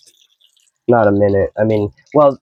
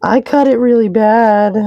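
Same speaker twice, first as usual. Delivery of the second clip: much higher squeaky comic voice; 9 semitones higher than usual. Acted. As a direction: squeak it out in a silly high voice. Comic high voice after cutting.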